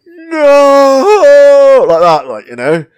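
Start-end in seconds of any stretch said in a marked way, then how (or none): 0.1-1.8 long, loud, high "Nooo!"; hammed-up, drawn-out wail of No; melodramatic death cry. Mocking bad game acting, a hammed-up dying wail. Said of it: "when someone dies and they go".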